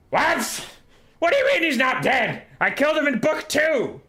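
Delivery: high, screechy outrage; outraged disbelief. Acted. Skrulk told Thanquil is not dead, screeching outrage. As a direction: screech back in indignant disbelief.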